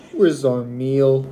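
ordinary talk, nothing marked

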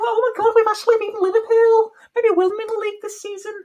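very high, loud squeaky giddy voice; mock Everton fans celebrating. Everton fans after beating Liverpool, high giddy squeal. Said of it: "And they're like".

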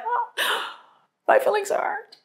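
mock-wounded, high gasping whine; hurt feelings. Wife replaying her easily hurt younger self, mock-wounded.

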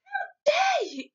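high, loud, slow outrage; outraged cry. A narrator's reading. Sophie outraged about the Queen, indignant cry. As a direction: cry out, drawing each word. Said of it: "Sophie cried".

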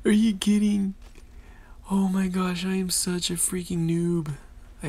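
loud, high disbelief at yourself; exasperated outburst. Real, not acted. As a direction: cry out at your own blunder. Outraged at own botched attack.

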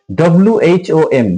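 ordinary talk, nothing marked

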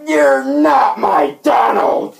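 slow, high, loud mock-anguished shout; hammed-up shout. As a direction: wail it at the impostor. Acted outburst at fake Donald.

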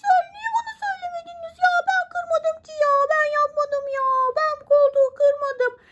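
high, protesting toy voice; whiny puppet voice. Acted. Mother voicing toy Miki to her daughter, high whiny puppet voice. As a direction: plead innocence like a wronged little toy.

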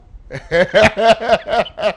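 loud, high burst of laughter; hearty laugh. Real, not acted. Host cracking up after greeting viewers in French, hearty laugh.

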